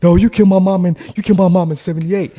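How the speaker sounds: high, loud accusation; accusing shout. Retold or imitated. Man pointing at the visitor, shouting high. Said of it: "he's literally, like, pointing at me".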